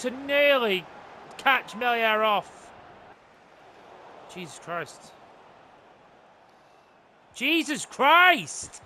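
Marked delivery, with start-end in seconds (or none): 4.3-5.1 low, very soft curse under the breath; quiet dismay. Real, not acted. Muttered reaction to missed chance. 7.3-8.8 slow, drawn-out exclamation of frustration; exasperated outburst. Real, not acted. Same curse again, now exasperated.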